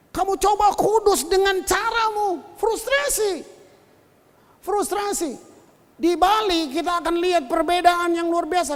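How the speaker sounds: high, loud pulpit exhortation; forceful preaching. Real, not acted. Preacher warning that striving for holiness frustrates, forceful preaching. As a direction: drive the point home to the congregation.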